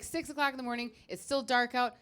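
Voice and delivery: ordinary delivery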